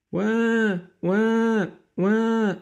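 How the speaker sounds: high, loud wah-wah wail; baby crying. Acted. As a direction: cry out like a bawling baby. Child crying heard.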